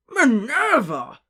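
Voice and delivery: loud, high, drawn-out shock; scandalized cry, stretched out; aghast. A narrator's reading. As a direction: exclaim the name in slow horror. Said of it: "he said aghast".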